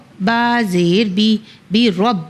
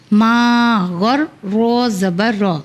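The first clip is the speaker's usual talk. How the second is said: usual speaking voice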